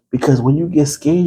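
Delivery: ordinary talk, nothing marked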